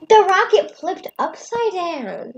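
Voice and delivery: incredulous emphatic recounting; excited. Real, not acted. Excited overlapping argument.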